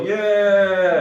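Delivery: loud, high imitated Parliament "Yeah"; a hearty MP's "Yeah" instead of applause; a brief hammed-up Parliament cheer; mock MP cheer. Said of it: "shake the paper and go".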